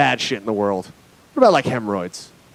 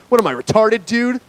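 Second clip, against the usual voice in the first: high, incredulous mock Vegas local; scornful local; 6 semitones higher than usual. Vegas local answers a dumb question, high-pitched scorn. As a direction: answer as if asked something idiotic. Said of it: "look at you like you're an idiot".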